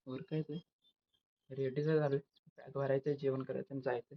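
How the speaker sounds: usual speaking voice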